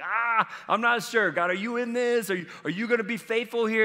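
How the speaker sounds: high, hesitant questioning; acted doubt. People questioning God's call, acted doubt. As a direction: play an anxious doubter, pitched well up.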